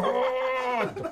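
高く長く伸ばした「ドーン」; 笑い交じりに飛び出す迫力を真似る; 勢いある擬音の実演. Retold or imitated. サメが飛び出す様子を擬音で再現. (大げさに間延びさせた擬音語)

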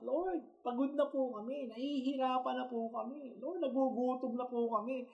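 high, soft, tired crying-out to the Lord; voicing the people's weary, high, soft complaint to God; pleading prayer. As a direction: beg God like an exhausted, struggling believer. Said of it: "We are crying out".

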